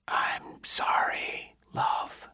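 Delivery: slow, breathless whisper; ghostly whisper. A narrator's reading. Dead wife whispering through the recorder, slow and ghostly. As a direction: barely breathe the words out.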